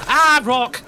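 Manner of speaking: sudden high loud yelp at a rock; startled yelp. Real, not acted. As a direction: jump as the rock appears, crying out.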